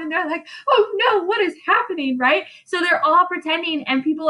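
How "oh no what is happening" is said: high squealing faked alarm; hammed-up frightened girls crying out; very high, crying panic; mock panic. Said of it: "looking around".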